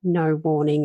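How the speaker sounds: ordinary talk, nothing marked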